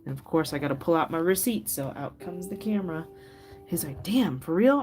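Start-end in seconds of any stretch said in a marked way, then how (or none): 4.0-4.8 higher, louder disbelieving dad; mock-shocked "for real?"; surprised dad. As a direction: react like a caught dad seeing proof. Dad reacts to video proof.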